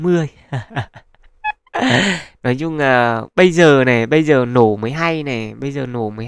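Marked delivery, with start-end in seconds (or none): none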